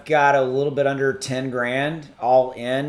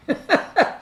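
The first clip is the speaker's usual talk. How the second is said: emotional laugh. Real, not acted. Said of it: "I'm about to cry".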